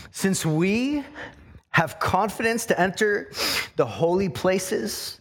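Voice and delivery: measured scripture reading, pausing between phrases; slow reading. Real, not acted. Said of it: "I'm gonna read this slowly".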